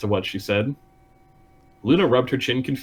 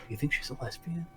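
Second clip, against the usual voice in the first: a near-silent, conspiratorial mock whisper; mock hushed whisper; 2 semitones higher, 17 dB quieter than usual. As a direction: gossip it under your breath. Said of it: "hushed whispering about it".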